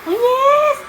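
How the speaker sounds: very high, loud doting squeal; high-pitched, excited praise for a pet. Real, not acted. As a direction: squeal it at the puppy. Praising pet in baby talk.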